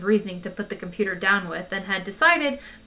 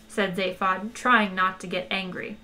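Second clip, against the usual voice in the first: usual speaking voice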